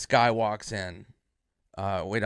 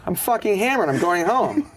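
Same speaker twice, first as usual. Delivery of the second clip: high, loud comic exasperation; retold loud protest; 9 semitones higher, 8 dB louder than usual. Comic retelling leaving bar on late friend, loud exasperation. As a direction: announce it, mock fed up.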